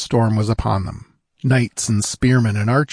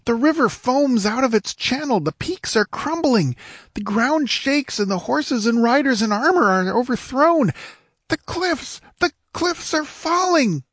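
very high voice of mounting horror; high-pitched panic, moderate volume; horrified shrieking. A narrator's reading. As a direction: cry out as the world collapses. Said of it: "shrieked the squire".

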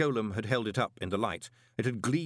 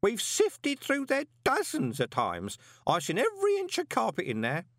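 refusing to believe it; protesting old Groat; 12 semitones higher than usual. A narrator's reading. Groat arguing with the golem, insistent.

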